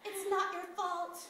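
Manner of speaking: gently absolve a distraught friend; hushed, unhurried comforting; soothing consolation. Acted. Friend consoles host after confession.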